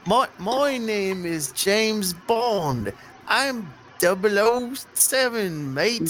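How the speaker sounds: slow, hammed-up British Bond accent, mangled into Aussie; a terrible British spy drawl; bad British accent. Fake British accent, unsure of itself, for the table. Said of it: "We need the accent".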